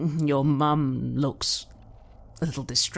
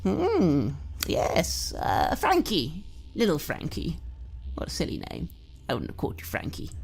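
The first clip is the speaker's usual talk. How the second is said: creepy doting mother. Acted. Mother wheedling her grown son Joshy, creepy doting voice. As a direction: coax your son sweetly, menace underneath. Mother rambles about names.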